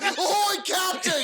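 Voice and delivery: high, eager "ahoy, captain"; sailor crew reply. Retold or imitated. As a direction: answer like a loyal pirate crew. Bums answering like sailors.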